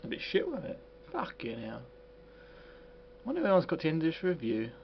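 very quiet off-hand mumble; muttered aside. Real, not acted. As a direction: mutter it to yourself after signing off. Muttered aside after review ends.

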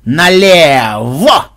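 loud, hugely stretched turning order; shouted command. A narrator's reading. Leader steering the running players left, in a drawn-out command shout. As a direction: drag the word out, then release it. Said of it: "слушайте мою команду".

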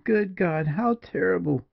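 high-pitched, appalled woman's cry; shocked outcry, pitched well up; horrified. A narrator's reading. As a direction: exclaim it high, aghast. Julia horrified by the news.

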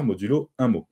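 normal talking voice

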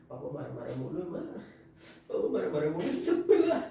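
a light mock of a sulky child complaining; whiny kid quote. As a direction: grumble like a fed-up kid, half-laughing. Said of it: "kamu kesel katanya". (meniru anak yang kesal, setengah tertawa)

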